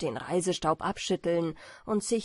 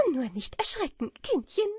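high character voice, mock reassurance; amused bat; 6 semitones higher than usual. A narrator's reading. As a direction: soothe your prey in a high, amused voice. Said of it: "lachte Amanda". (belustigte Fledermaus, hohe Stimme)

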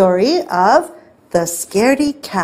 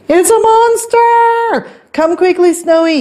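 panicked yell; 11 semitones higher, 4 dB louder than usual. A narrator's reading. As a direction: yell for help in storybook terror. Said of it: "yelled Stanley".

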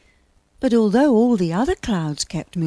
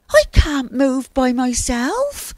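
high, slow, loud protest; high-pitched aggrieved character; 6 semitones higher, 6 dB louder than usual. A narrator's reading. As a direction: protest helplessly in a high, drawn-out voice. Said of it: "protested Cloud".

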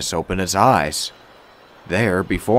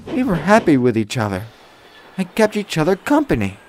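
high-pitched gentle storyteller; kind young woman, reminiscing; 8 semitones higher than usual. A narrator's reading. Woman tells Thomas of losing grandpa, gentle and high. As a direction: tell the memory in a high woman's voice.

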